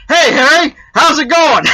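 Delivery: high, loud squawky parrot line, cracking up. Acted. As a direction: play the parrot's punchline while laughing. Parrot speaks in joke punchline.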